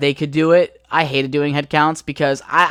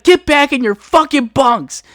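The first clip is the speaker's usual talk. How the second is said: higher, louder barked command; barked order; 10 semitones higher, 6 dB louder than usual. Retold or imitated. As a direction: order them back to bed. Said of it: "He's like".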